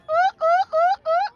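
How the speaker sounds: high, quick-fire whoops; short high cheering hoots; excited hooting. Real, not acted. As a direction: hoot four times in celebration. Cheering at fireworks show.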